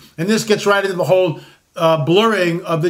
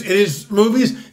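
ordinary talk

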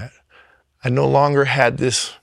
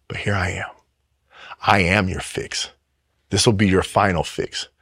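calm divine voice; 5 semitones lower, 4 dB quieter than usual. Retold or imitated. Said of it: "he was like".

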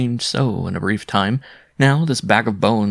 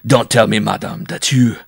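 loud, raised, incredulous questioning; astonished cry; 4 semitones higher, 5 dB louder than usual. A narrator's reading. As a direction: blurt it out in disbelief, then break off. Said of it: "the bareback rider cried".

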